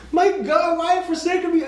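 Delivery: high, strained anguished cry; hammed-up desperate shout; acted dying scream. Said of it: "the Bible tells us he died screaming".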